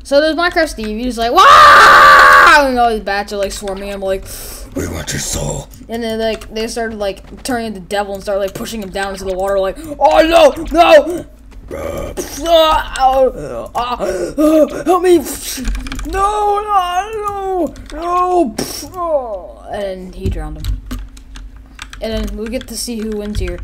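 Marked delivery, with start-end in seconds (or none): 1.3-2.7 long loud hammed-up "wah"; loud drawn-out yell; mock scream. Voices Steve screaming. 4.8-5.7 very low croaky demon voice; low, eerie mock-demonic chant; creepy bats. 10.0-19.7 high, spluttering cries for help; hammed-up desperate gasping yells; mock drowning panic. Acts out being drowned.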